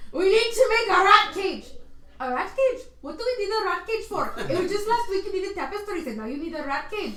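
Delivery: put-on an assistant grumbling back in a failed Scottish accent; giggly, hammed-up Scottish grumble; attempted accent. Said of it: "I can't do this accent".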